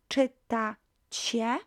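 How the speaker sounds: "Czytacie" drawn out in separate syllables; slow syllable-by-syllable model. Shown as a demonstration. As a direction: model "Czytacie" slowly, rising at the end. Teacher models the new verb form.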